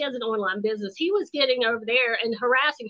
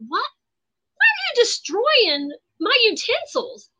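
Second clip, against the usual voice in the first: high incredulous what, why; mock outraged squeak at the spoon; exasperated disbelief, retold; retold outrage; 10 semitones higher than usual. Retold outburst at the ex.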